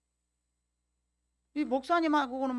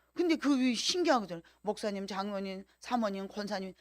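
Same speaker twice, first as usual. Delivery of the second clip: ordinary delivery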